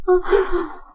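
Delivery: high breathy whimpered "oh"; small high-pitched whimper. Acted. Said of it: "The way I whimper".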